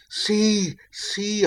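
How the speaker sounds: high, eager call; excited cry. Acted. Mariner calling shipmates to the approaching ship, excited high call. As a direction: point it out with urgency. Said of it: "I cried".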